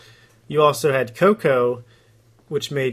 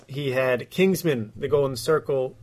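usual speaking voice

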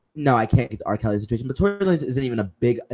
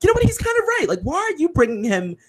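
high-pitched exasperation. Retold or imitated. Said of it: "And I'm like".

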